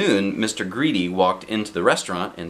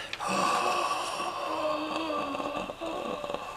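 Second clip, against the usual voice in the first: a big audible gasp before a long speech; deep breath in; 10 dB quieter than usual. Acted. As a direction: suck in a huge breath. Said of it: "taking a deep breath".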